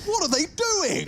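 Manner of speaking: high, loud disbelief; shocked outburst. Real, not acted. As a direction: yell it in shock. Caster shocked by a play.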